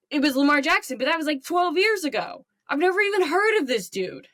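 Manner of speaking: high-pitched, exasperated sports fan rant; upset caller. Acted. Fan calling sports radio about draft pick, angry rant. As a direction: rant at the radio host, incredulous and heated. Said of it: "upset about".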